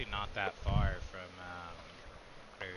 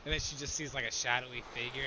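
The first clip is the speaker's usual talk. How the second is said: usual speaking voice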